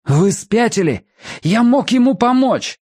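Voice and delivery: angry outburst. Acted. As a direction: lash out at the shooter. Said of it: "кожа его пылала от гнева".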